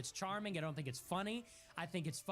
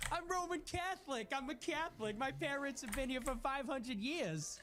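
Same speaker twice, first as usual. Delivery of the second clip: high, nasal-free mimic of the caller, much higher; mock caller voice; 9 semitones higher than usual. Host mocking a caller's claim, in a high mock voice. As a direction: parody the caller's insistence, pitched way up. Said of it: "Dude, that was crazy".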